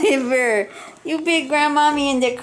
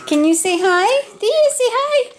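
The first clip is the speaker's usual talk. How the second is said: high sing-song calling a puppy; puppy coaxing; 10 semitones higher than usual. Real, not acted. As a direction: call her name high and sweet.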